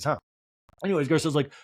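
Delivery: normal talking voice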